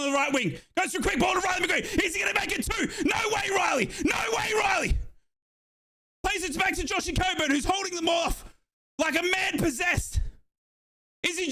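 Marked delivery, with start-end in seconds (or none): none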